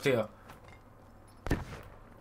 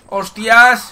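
surprised; 3 semitones higher, 8 dB louder than usual. Real, not acted. Sudden danger in game.

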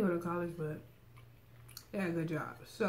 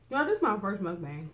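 normal talking voice

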